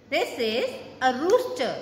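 ordinary delivery

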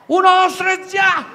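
high, loud countdown yell; countdown shout. Retold or imitated. Countdown before lighting fireworks.

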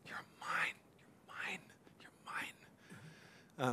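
slow, drawn-out whisper; God's tender whisper. Acted. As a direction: whisper it slowly, as God claiming a beloved. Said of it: "could we hear God's voice saying".

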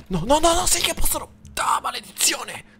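very high, loud panicked cry. Real, not acted. Gamer realizing monsters can reach him, very high panic. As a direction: cry out as the safe spot fails.

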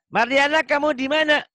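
put-on high, piercing little-kid call; child's voice. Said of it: "yang nanya nih, anak kecil". (suara anak kecil yang bertanya keras)

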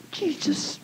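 breathy whispered name, barely audible; under my breath. Retold or imitated. Praying to Jesus in the car, whispered. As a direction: whisper it, hiding it from the gunman. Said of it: "But under my breath".

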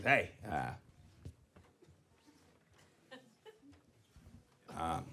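low, soft fillers with long pauses; awkward hesitation. Retold or imitated. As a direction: imitate a man groping for words. Jerry struggles to say something tender.

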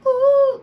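sharp delighted squeal; high squealing ooh. Acted. High loud exclaim.

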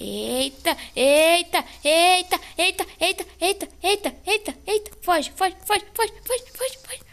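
high, loud frantic chant; panicking gamer. Real, not acted. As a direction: scream at it to flee. Killer chasing, urging to flee.

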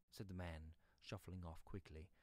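plain reading voice

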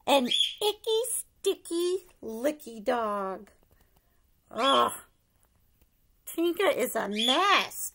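plain reading voice

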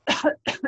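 dry voiceless cough. Acted. Lorax choking on smog, coughing. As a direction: hack out a cough. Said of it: "He coughed and he whiffed".

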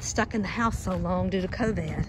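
ordinary talk, nothing marked

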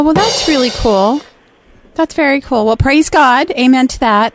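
ordinary talk, nothing marked

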